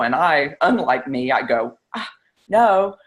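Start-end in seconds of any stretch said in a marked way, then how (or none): 2.0-3.0 firm, take-charge retort; suddenly assertive, claiming authority; bossy. Retold or imitated. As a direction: put her foot down, unlike herself. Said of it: "which is completely not like me".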